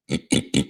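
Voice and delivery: imitated pig grunts in a long run; pig noise. Shown as a demonstration. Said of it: "not as good as my pig noise".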